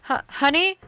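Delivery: high, loud, halting call. Acted. Mom calls after daughter going upstairs, calling out. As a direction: call after someone who is leaving.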